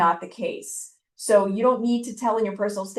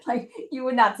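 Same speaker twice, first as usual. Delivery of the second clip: laughing; 4 semitones higher than usual. Real, not acted. Laughing at the brag.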